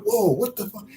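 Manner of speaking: high, startled outburst at a shadow; startled whoa. Retold or imitated. Retelling a startle by a tall stranger, high startled outburst. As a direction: jump back with a high startled curse. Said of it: "I was like".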